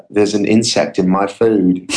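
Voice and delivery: slow, put-on British accent; badly faked British English, drawn out; horrible British accent. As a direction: do a bad British accent, slowly. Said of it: "in my horrible British accent".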